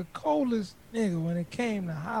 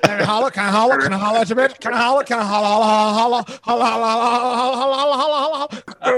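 loud, rapid-fire chant of a pickup line; mock relentless pestering; 11 dB louder, faster than usual. Friend mimics a guy hounding a girl, loud mock badgering. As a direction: hammer the word over and over, shouting.

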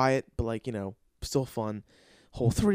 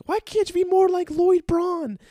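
much higher, loud, slow nagging mother; hammed-up nagging mom; George's mom nagging; 9 dB louder than usual. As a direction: whine it like a sitcom mom. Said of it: "George's mom would kept saying".

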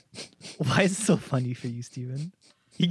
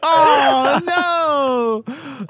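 loud real shocked oh, no; shocked cry; 11 semitones higher, 7 dB louder than usual. Real, not acted. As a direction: cry out in horror. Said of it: "That's awful".

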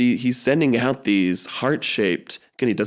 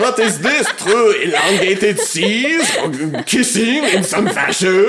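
higher, louder mock awe; amazed villager; 6 semitones higher, 3 dB louder than usual. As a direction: gasp over it like a baffled villager. Townsfolk marvel at heart shape.

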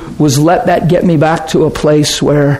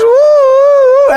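high, louder whoop of joy; joyful whoop; 5 dB louder than usual. Retold or imitated. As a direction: let out the wife's celebration cry. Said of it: "His wife's".

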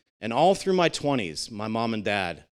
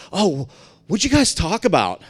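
higher, louder put-on wife voice; voicing the wife; 6 semitones higher, 5 dB louder than usual. Wife quizzing husband about the fishing chat, mock higher voice. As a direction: play the nosy wife, a bit hammed-up.

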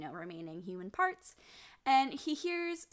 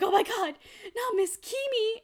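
high, louder hammed-up gasping alarm; mock alarm; 11 semitones higher, 6 dB louder than usual. Host hamming the hero's horror at the kidnapping.

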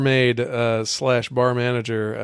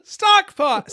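loud announcement; 3 dB louder than usual. Acted. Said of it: "Say it with me".

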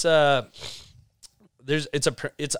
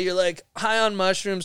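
normal talking voice

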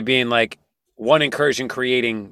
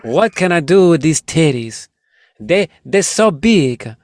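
put-on Italian accent; 4 semitones higher, 4 dB louder, slower than usual. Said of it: "in an Italian accent".

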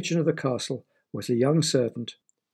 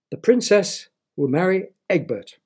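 higher, louder royal proclamation; grand announcement; 6 semitones higher, 5 dB louder than usual. A narrator's reading. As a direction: reveal the name triumphantly. Queen reveals the groom.

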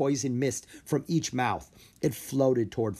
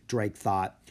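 ordinary delivery

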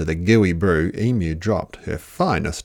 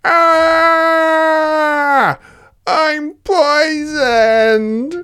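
high, loud, slow dying cry; overacted death scene; hammed-up poisoned shout; 7 dB louder, much slower than usual. Dingo shrieks after slurping the stew.

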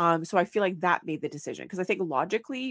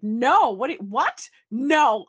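exasperated outburst; 5 semitones higher, 9 dB louder, slower than usual. Retold or imitated. Quoting an exasperated reaction.

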